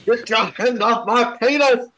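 high, loud Aussie outraged yell; shouted impression. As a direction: scream it like the viral guy. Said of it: "that's a great impression".

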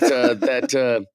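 usual speaking voice

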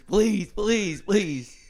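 high pleading coach on his knees, loud then breathy; mock begging. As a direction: beg the line judge, voice shooting up.

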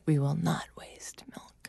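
soft unvoiced whisper; hushed muttered resolution. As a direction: whisper it to yourself like a vow. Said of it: "just being like".

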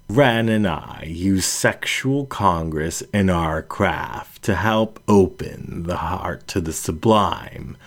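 usual speaking voice

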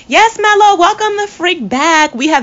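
high, loud fan welcome; elated cheer. Real, not acted. Fan welcoming Melo back to the league, elated shout. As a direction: shout it with joy, stretching the end.

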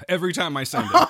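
ordinary talk

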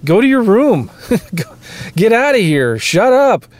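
put-on play-scold the whiny child, half laughing; mock parent yell. Mock parent scolding whining kids.

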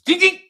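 high sung ding ding; mock bell sound. Imitating the impact of the crash as a high ding. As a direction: chime like a bell being struck.

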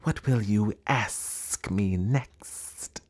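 very slow, drawn-out snake voice; python rock snake. Acted. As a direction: stretch every word like a pompous serpent. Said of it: "This is the way bi-colored python rock snakes always talk".

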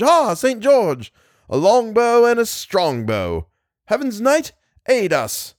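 high, ringing calls of a phantom host; shouted battle cries. A narrator's reading. Thousands of bowmen call on Saint George, shouting. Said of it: "thousands shouting".

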